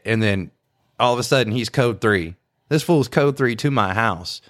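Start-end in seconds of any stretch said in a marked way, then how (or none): none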